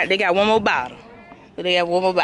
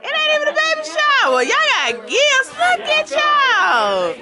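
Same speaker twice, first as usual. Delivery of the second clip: high, drawn-out delighted exclamation; surprised squeal; 12 semitones higher, slower than usual. Real, not acted. Guest of honor spotting unexpected gifts, amazed high squeal. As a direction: stretch it out slow and very high in amazement.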